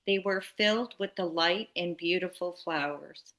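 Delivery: usual speaking voice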